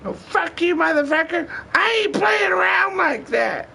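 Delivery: very high shrieking mock yell of an angry woman; imitating screaming partner. Imitating his partner yelling at him over the snake, high mock shriek. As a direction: shriek it like the furious girlfriend.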